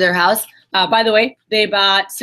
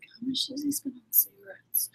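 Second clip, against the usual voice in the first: whisper; 6 semitones higher, 17 dB quieter than usual. Retold or imitated. Whispered question about husband's spending.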